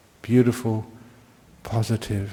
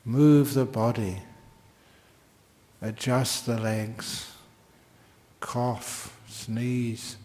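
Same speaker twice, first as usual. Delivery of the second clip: guided meditation; 3 dB quieter than usual. Real, not acted. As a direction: ease listeners into stillness gently. Leads the class into meditation.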